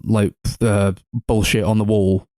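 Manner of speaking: usual speaking voice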